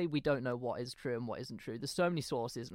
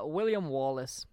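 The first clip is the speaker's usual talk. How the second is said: slow, put-on Scottish accent; slower than usual. Co-host answering who today's subject is, Scottish accent. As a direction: say it slowly in a mock Scottish accent. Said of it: "last time I'm doing a Scottish accent".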